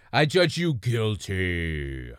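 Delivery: low, slow, solemn judge's pronouncement; playful judge voice, dragged out and deep; mock judge verdict. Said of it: "I would love to be the judge".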